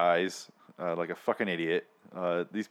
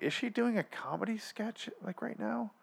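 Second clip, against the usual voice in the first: incredulous mock; 13 semitones higher, 8 dB quieter than usual. Mocking the actress's performance.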